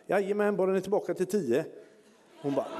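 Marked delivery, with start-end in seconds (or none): 0.1-1.6 high, fast, breezy yes; higher, quick, lightly voiced doctor; cheery doctor. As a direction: toss off an easy cheerful permission.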